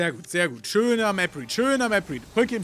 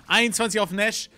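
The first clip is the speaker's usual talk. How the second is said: ordinary talk, nothing marked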